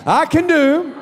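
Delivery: shouted congregational confession; call-and-response declaration. Shown as a demonstration. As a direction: lead the church in declaring it. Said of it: "say that with me".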